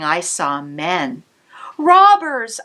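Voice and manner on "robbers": loud high shout of warning; cry of alarm. Acted. Girl spotting robbers, crying out loud in alarm. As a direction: yell out Robbers!. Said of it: "I cried".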